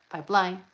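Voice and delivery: pipeline with LINE lifted; accent the second syllable of pipeline; pipe-LINE, stressed at the back; line goes up. Shown as a demonstration. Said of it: "Line goes up".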